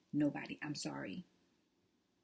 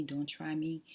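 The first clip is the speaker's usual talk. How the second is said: ordinary delivery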